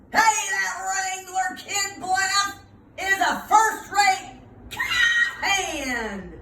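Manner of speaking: loud, high cheer; cheering shout. Acted. Townsfolk cheering the Wrangler Kid, loud and high. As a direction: shout it out like praise spreading over the land. Word spreads, cheering Wallace.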